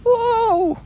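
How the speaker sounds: squeaky high yelp going up; high whoa. Acted. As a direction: yelp it high like a small animal flung upward. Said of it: "he said".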